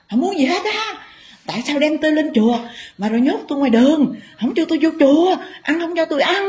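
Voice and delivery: very high, strained sulky spirit voice; possessed spirit voice. Acted. Possessing dog spirit answering the Mother sulkily, very high-pitched. Spirit complaining through the woman.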